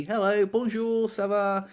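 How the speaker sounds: high, drawn-out singsong checkout hello; chirpy greeting. Acted. Cashier greeting supermarket customers in French, chirpy. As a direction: greet shoppers in a cheery high voice. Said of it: "like a good old chirpy".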